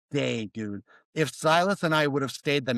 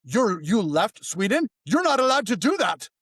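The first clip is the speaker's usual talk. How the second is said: mock disbelief that climbs higher and louder; mock shocked official; 6 semitones higher, 3 dB louder than usual. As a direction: play the stunned bureaucrat, rising in pitch. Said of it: "the guy was like".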